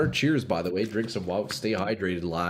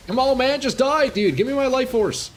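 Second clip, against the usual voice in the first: high, loud plea for the boss to die; real exasperated shout at the game; frustrated yell; 4 dB louder than usual. Real, not acted. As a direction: yell at the screen, begging it to just die. Frustrated gamer yelling at boss.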